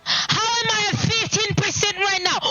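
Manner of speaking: high, tearful wail; crying. Real, not acted. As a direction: cry out the words, voice high and breaking. Streamer upset about missing donation.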